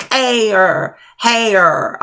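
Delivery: "air" and "hair" drawn out as ay-er, hay-er; "air" then "hair", each with an audible second beat; almost two syllables. Shown as a demonstration. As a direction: stretch "hair" toward hay-yer. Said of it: "It's almost what it sounds like".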